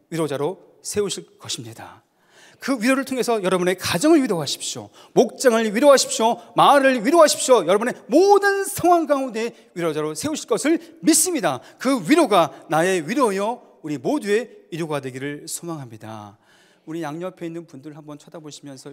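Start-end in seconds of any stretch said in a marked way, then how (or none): 2.6-11.6 louder and higher, rising with each line of blessing; building sermon climax. Acted. 설교 절정의 선포. 11.8-16.4 louder, stretched out slowly; slow preaching emphasis. Acted. 선포를 천천히 끌어 강조.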